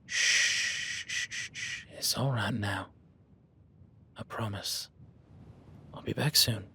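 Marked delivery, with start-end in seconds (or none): 0.0-4.9 soothing shush. Acted. Soothing a nervous horse.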